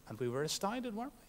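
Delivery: ordinary delivery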